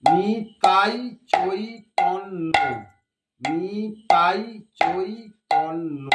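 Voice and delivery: very slow, each syllable stretched; slow practice chant; slow demo. Shown as a demonstration. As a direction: count it out slowly for the student. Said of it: "তাহলে নি".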